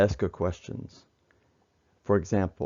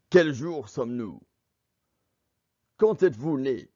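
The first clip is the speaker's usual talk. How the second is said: firing questions like an interrogator; raised, insistent voice demanding answers from a prisoner; 3 semitones higher than usual. Acted.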